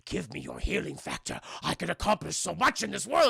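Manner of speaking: put-on old villain voice, pleading and demanding; greedy old villain. Old man begs hero for healing factor, greedily.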